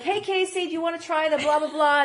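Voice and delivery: high, loud, chirpy barista; bubbly barista greeting. Retold or imitated. Imitating the Jamba Juice staff greeting her, chirpy and high. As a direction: greet the regular with big cheer. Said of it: "every day they're like".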